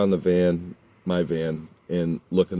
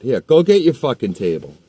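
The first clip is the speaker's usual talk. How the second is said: loud, higher scornful shout; angry taunt; 5 semitones higher, 6 dB louder than usual. Retold or imitated. To the kid after throwing his table, loud taunt. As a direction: fling the words after the table. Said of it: "throws the table".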